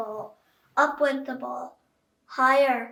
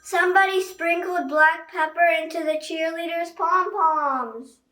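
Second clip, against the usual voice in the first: fan shout; 5 semitones higher, 7 dB louder than usual. A narrator's reading. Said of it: "shouted a fan".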